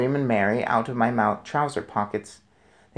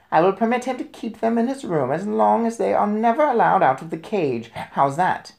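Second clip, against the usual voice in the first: manager; 8 semitones higher, 3 dB louder than usual. A narrator's reading. Manager offers a compromise.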